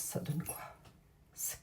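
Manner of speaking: hushed, to herself; whispered. Shown as a demonstration. Asking her viewers the question again, in a whisper. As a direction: breathe the question out almost unvoiced.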